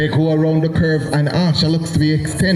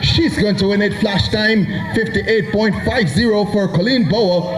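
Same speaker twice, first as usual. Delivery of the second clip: raised, driving play-by-play; excited race call; 4 semitones higher than usual. Real, not acted. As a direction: call the finish with energy. Live race call, rising pitch.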